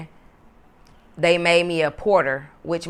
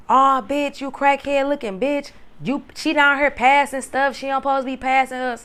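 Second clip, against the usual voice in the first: higher, mock-yelled insults; retold angry yelling; 8 semitones higher than usual. Cellmate yelling insults through the cell door, imitated angry yell. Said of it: "she really yelling out the door".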